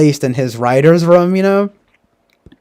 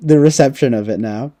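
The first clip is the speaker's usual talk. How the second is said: ordinary delivery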